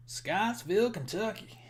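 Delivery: playfully faked Southern accent, pitch lifted. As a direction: put on a not-so-good Southern twang. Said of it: "my southern accent is, isn't as good".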